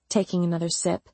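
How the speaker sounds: usual speaking voice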